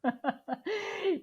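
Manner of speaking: high, bursting laugh; amused laugh. Real, not acted. Guest hears host promise easy fixes, amused high laugh.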